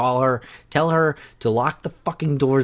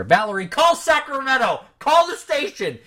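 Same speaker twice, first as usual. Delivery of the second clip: high frantic mock panic call; panicked calling; 13 semitones higher than usual. Host calls out to Valerie in hammed-up panic. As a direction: shriek for help in hammed-up terror.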